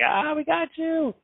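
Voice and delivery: spring the prank with a high drawn-out cry; gotcha shout. Retold or imitated. Cousin leaps from the bushes at Qbert, high slow gotcha cry.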